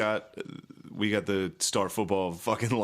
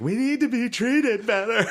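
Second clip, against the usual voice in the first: mock Harvey Milk; 12 semitones higher, 4 dB louder, slower than usual. Harvey Milk voiced mockingly.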